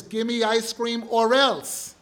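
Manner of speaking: put-on loud, slow kid's ultimatum; a spoiled kid raising the stakes; threatening child.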